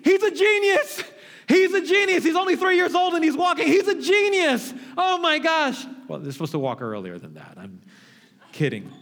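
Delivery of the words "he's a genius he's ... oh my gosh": very high, loud, gushing proud-parent squeal; overexcited parent. Retold or imitated. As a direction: brag breathlessly about your genius baby. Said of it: "you're so excited".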